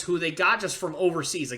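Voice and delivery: ordinary delivery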